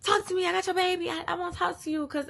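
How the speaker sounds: high-pitched mock begging voice; imitated pleading. Her past self blowing up his phone, high pleading imitation.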